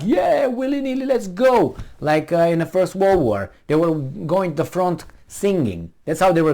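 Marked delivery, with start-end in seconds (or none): 0.0-1.8 mock-eager, much higher voice; gung-ho. Mocking eager allies cheering on the war, high and giddy. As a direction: ham up a carefree cheer for war. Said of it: "Not everybody was like".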